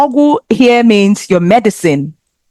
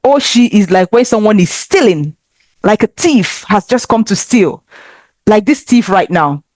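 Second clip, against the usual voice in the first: usual speaking voice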